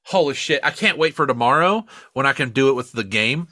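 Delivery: normal talking voice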